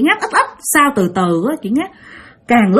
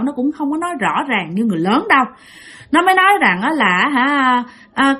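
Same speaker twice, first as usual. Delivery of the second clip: normal talking voice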